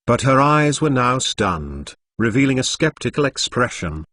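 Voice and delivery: plain reading voice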